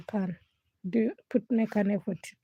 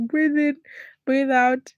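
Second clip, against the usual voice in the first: imitating papa; 5 semitones higher, 6 dB louder, slower than usual. Dad guiding her breathing.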